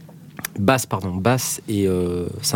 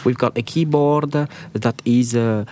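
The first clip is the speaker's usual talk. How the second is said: put-on Italian accent; 2 semitones higher, slower than usual. Host imitating the Italian demonstrator's sales pitch, in a slow Italian-accented English. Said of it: "je fais pas très bien l'italien qui parle anglais".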